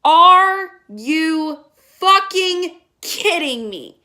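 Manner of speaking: loud, very slow, word by word; drawn-out exasperated disbelief; incredulous outrage. Real, not acted. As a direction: spell out the absurdity slowly and forcefully. Incredulous at plot point.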